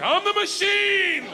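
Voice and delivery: very high, stretched-out holler; triumphant yell. Retold or imitated. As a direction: bellow it like a party catchphrase. Triumphant bellowed catchphrase to gangster.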